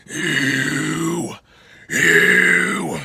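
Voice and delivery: raspy, drawn-out growled scream; low scream attempts. Shown as a demonstration. Coach struggling to get the low register, low scream. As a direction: keep working the low rasp. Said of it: "it's not that great right now".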